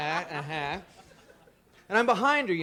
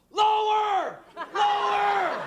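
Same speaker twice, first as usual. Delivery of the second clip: high shouted Lower, laughing; yelled Lower; 6 dB louder, much slower than usual. Pretend. Comic at Bob Barker's funeral, yelling high and loud. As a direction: yell it across the funeral. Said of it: "and yell".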